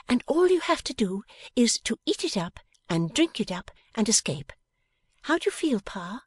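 bright eager daughter, hushed; young woman voice. A narrator's reading. Bella voiced.

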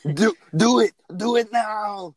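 loud, slow Austrian order; hammed-up Arnold barking an order; Schwarzenegger impression. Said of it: "shitty Schwarzenegger impressions".

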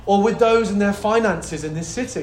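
ordinary delivery

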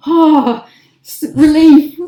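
loud, high exaggerated relief; relieved sigh. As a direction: let it all out in a big relieved oh. Relief cops let them go.

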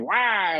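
high, drawn-out mock wail; imitated falling yelp. Guest recounting another ski tumble, mock falling cry. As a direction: yelp as if toppling over.

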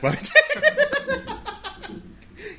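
shrieking high laugh; high cackle. Real, not acted. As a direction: break into a squealing cackle. Cracking up at own joke.

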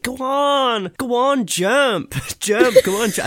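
hammed-up foreign boy egging him on, high pitch; mock German boy accent. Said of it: "I don't know what accent that is".

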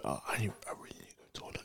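frantic whisper; urgent hushed whisper to the guys. Real, not acted. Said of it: "Why are you whispering?".

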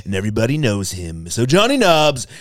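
loud, stretched-out Trump voice; Donald Trump impression. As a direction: boast to the crowd like Trump. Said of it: "best Donald Trump impression".

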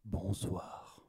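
low, soft sensual purr. Acted. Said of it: "Oh, quelle voix sensuelle".

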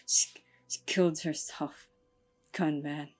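rasping low whisper; voice from the grave. A narrator's reading. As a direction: rasp it like a voice from the grave. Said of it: "Rasp Kat in a voice from the grave".

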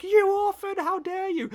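high-pitched, hammed-up scolding; mock outrage. Mum confronting the orphan, hammed-up high-pitched outrage. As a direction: burst out high and loud, mock-furious.

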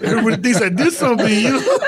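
high-pitched, through real laughter; amused, words breaking into laughs; laughing while talking. Real, not acted. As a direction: crack up telling your own story. Laughing at his own story.

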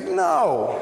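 a high, clipped mock "No". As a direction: squeak the refusal out. Prince flatly refuses the song.

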